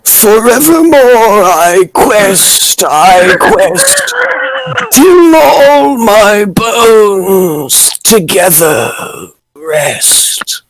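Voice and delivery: high, very slow, drawn-out ghostly moan. Acted. Doing the ghost of Nicholas Grebe's line, slow high ghostly wail. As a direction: stretch every word like a wailing old ghost. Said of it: "I make a great ghost".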